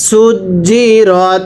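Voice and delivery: সুজি রত said very slowly with a firm জ; slow drilled reading. Shown as a demonstration. Teacher drills সুজি রত slowly for students. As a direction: drag out সুজি রত, hardening the জিম. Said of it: "কিভাবে পড়তে হবে".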